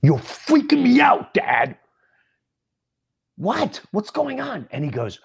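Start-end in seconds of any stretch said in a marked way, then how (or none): 0.0-1.8 very high, louder retold panic; alarmed outburst. Son to unresponsive Dad, alarmed high outburst. 3.3-4.7 very high, squeaky questions; alarmed questioning. Retold or imitated. Son asks shushing Dad, alarmed high voice.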